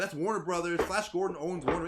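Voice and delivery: heated retort. Retold or imitated. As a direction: fire it back at him, loud and fed up.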